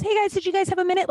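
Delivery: high, quick, sugary check-in; nice as pie to the table; sweet waitress voice. Retold or imitated. Sweet waitress returning to the silent couple, high and sugary. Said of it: "nice as fucking pie".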